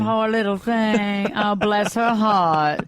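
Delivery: very slow Southern belle drawl; Scarlett O'Hara. Acted. Therapist echoing co-host's mock pity, Southern belle. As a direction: drawl out syrupy Southern sympathy. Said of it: "the Scarlett O'Hara thing going there".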